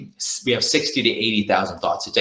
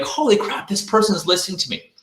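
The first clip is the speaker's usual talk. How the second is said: a client suddenly realising someone listens; amazed; 8 semitones higher than usual. Pretend. Client amazed the salesperson listened, high delighted surprise. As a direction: pitch jumps way up in delighted surprise. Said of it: "they'll be like".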